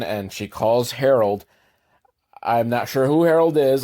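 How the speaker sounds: ordinary delivery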